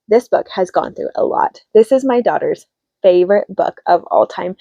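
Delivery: normal talking voice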